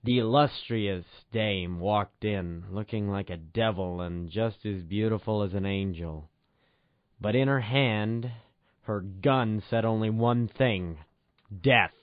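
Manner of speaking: slow, low hardboiled detective read; slow, low noir voiceover; noir narration. Shown as a demonstration. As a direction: savor it like a film noir narrator. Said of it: "So, take this sentence".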